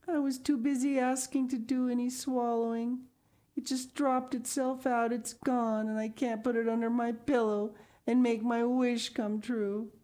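put-on high child's voice, a touch of sadness; lightly sad little girl. A narrator's reading. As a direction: complain wistfully to daddy. Sal laments lost tooth.

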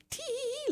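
very high little titter; squeaky-high storybook tee-hee; giggling. A narrator's reading. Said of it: "laughed the little woman".

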